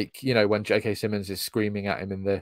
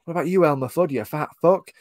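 higher-pitched mock yell at a recruit; raised-voice imitation of a bullying tirade; retold screaming; 6 semitones higher, 4 dB louder than usual. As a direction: berate them like a screaming instructor, half-strength. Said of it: "he's screaming at them and he says".

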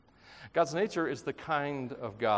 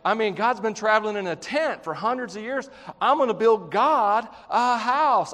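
David bragging; 6 semitones higher, 5 dB louder than usual. Retold or imitated. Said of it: "Here's David go like".

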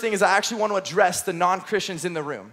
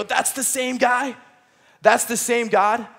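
slow, wondering that's the same God; awed realization; 4 semitones higher, slower than usual. Acted. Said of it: "you read these stories and you go".

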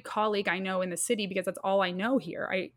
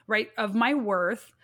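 ordinary delivery

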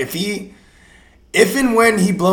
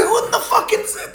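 much higher, laughing disbelief; baffled laugh; 4 dB quieter than usual. Retold or imitated. Joining the retold fantasy panic, a high, laughing disbelief. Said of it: "I'm like".

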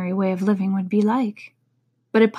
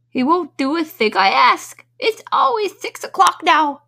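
mournful; 9 semitones higher, 7 dB louder than usual. Acted. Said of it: "in a mournful tone".

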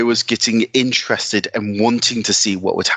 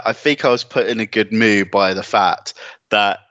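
usual speaking voice

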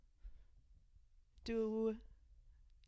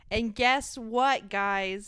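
teasing build-up; 8 dB louder, much slower than usual. Real, not acted. Building up big news.

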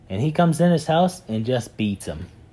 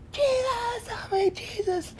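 mock scream for help; slower than usual. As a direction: cry out high and desperate. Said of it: "and he's screaming".

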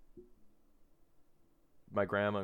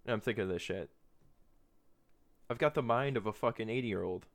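usual speaking voice

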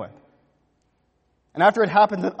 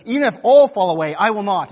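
bold boast; 4 semitones higher, 9 dB louder than usual. Retold or imitated. Peter boasts loyalty to Jesus.

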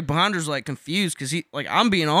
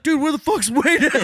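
put-on panicked drunk friend; 10 semitones higher than usual. Drunk roommate panics about friend.

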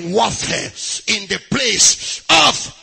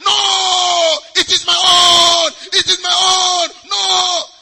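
imitating a child, higher and shouted; child shouting; 7 semitones higher, 6 dB louder, slower than usual. Preacher acting a child refusing to share his toy, shouting. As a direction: yell like a kid guarding his toy. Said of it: "The owner of the toy will".

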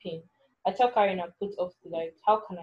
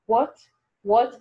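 sharp high disbelieving what; startled, rising disbelief; shocked; 4 semitones higher, 6 dB louder than usual. Acted. Kunle shocked at accusation.

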